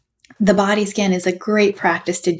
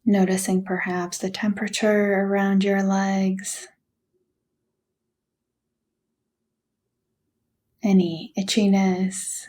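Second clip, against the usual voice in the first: guided meditation. Acted. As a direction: gently guide them through a body scan, unhurried. Leads a body scan meditation.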